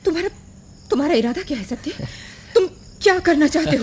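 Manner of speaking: stammering, rising alarm; frightened. Acted. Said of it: "डर गई".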